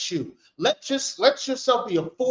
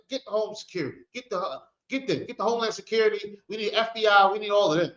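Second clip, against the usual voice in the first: ordinary talk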